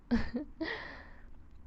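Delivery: high, airy, unvoiced chuckle; soft breathy laugh. Captor laughing at her captive's tears, breathy.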